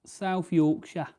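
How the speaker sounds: South Yorkshire said slowly in a thick South Yorkshire accent; broad South Yorkshire accent. Shown as a demonstration. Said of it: "Should be able to tell by the accent".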